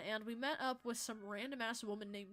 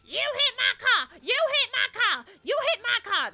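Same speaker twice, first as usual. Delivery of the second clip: yelling and hollering; 12 semitones higher, 16 dB louder than usual. Retold or imitated. Crash victim imitates the other woman, screaming accusation. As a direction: imitate a hysterical screamer at full volume. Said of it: "starts yelling and screaming and hollering".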